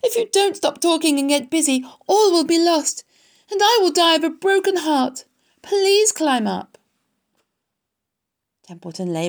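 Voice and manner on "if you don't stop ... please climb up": high, loud, pleading; desperate. A narrator's reading. Wilbur fears all will be lost, desperate. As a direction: beg with rising urgency, slowing on the final plea. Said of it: "said Wilbur in desperation".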